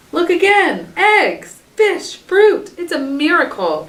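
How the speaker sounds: high, bursting with wonder; excited exclaiming. A narrator's reading. Mother hyena finding food everywhere, exclaiming in delight. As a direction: shout each find like a gift. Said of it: "exclaimed Mama".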